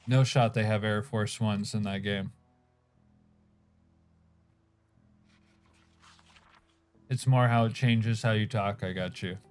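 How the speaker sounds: chewing while talking; mumbling through a mouthful; mouth full. Real, not acted. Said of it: "I got a mouthful while I'm talking".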